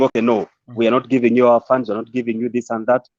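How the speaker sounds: usual speaking voice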